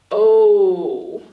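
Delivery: "Oh" held long and loud. Shown as a demonstration. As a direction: belt out a long open vowel. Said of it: "It's a long sound".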